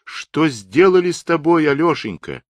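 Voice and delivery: high, loud lament; woman's anguished cry. A narrator's reading. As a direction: wail the question in distress. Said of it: "вскричала позади Татаринова баба в полушалке".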